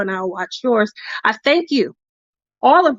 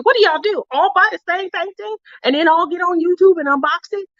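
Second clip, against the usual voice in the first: higher mocking mom voice; incredulous mom; 9 semitones higher than usual. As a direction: voice the baffled mom, pitched up.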